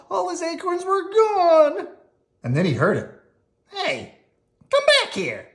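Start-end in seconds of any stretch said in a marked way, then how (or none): 3.7-5.5 high squeaky call after a runaway acorn; calling squirrel. Acted. Parent voicing squirrel chasing his acorn, high call. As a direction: shout high like a little squirrel.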